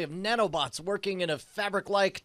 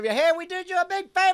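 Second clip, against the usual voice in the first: high-pitched indignant appeal; calling out in protest; 11 semitones higher than usual. Acted. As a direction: pitch it up, pleading your case from afar. Acted protest shouted at the villain.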